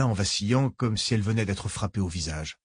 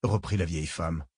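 ordinary delivery, nothing marked

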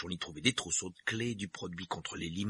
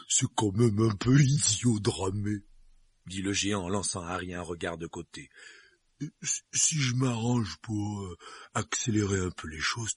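giant voice; 4 dB louder than usual. Acted. Hagrid voiced by narrator.

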